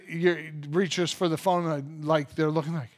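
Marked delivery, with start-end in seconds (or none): none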